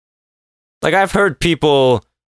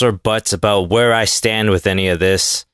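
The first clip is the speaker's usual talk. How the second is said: ordinary talk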